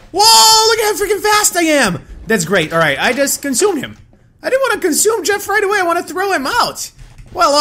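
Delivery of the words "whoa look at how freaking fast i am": amazed. Real, not acted. Amazed by character's speed.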